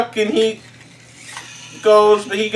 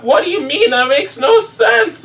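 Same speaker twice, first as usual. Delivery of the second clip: higher, puzzled protest; confused protest; 9 semitones higher than usual. Retold or imitated. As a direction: squeak up in disbelief. Confused character in the mud.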